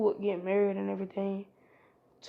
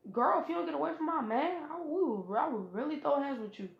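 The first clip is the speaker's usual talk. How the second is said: put-on higher, sassy girl warning; lightly voiced sassy girl telling off a rival; sassy threat; 6 semitones higher than usual. Fu threatens Hinata.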